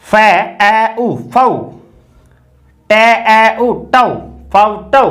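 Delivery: फे ए उ फौ broken into sounds, slow; sounding out slowly. Shown as a demonstration. Teacher blending sounds slowly for the class. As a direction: spell the sounds फे ए उ फौ one by one. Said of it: "अब इसमें देखिए".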